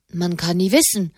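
ordinary delivery, nothing marked

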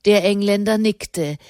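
ordinary delivery, nothing marked

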